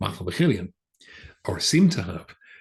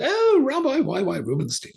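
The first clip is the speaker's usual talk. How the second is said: high, louder delighted "oh", imitated; excited recognition; 5 dB louder than usual. Librarian recognizing the broadcaster on the phone, jolly and excited. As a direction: greet a celebrity caller with a soaring, excited cry. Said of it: "he was very excited to be speaking to me".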